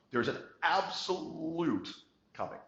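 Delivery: high, slow, hammed-up announcer swell; mock dramatic build. Shown as a demonstration. Presenter teasing the audience with a mock dramatic high slow voice. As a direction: stretch it out like a big reveal.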